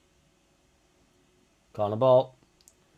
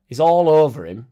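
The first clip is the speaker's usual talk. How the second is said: louder exasperated complaint; indignant protest; 4 semitones higher, 10 dB louder than usual. Real, not acted. Fan protesting a handball, loud and indignant. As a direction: protest at the referee.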